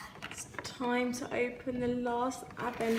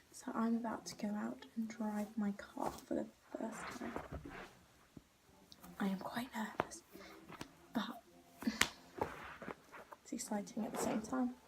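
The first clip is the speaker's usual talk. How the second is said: much softer hushed voice; whispering; 12 dB quieter than usual. Real, not acted. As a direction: whisper so no one hears. Nervous before first drive.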